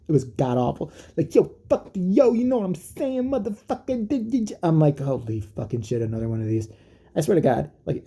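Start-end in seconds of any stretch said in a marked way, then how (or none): 1.3-4.5 high, slow, drawn-out parody rap voice; mock rapper. Mocking a singer's awful new single, hammed-up rapper voice. 5.0-6.7 weary disbelief. Retold or imitated. Own weary reaction, retold.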